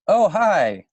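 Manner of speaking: surprised greeting. Real, not acted. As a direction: break off to greet someone who just appeared. Greets a child who appears.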